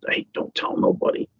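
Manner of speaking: retold father's hushed, voiceless warning. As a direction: pass it on like a family secret, under the breath. Father swearing child to family secrecy.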